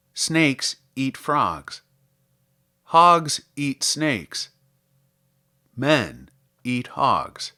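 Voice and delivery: ordinary delivery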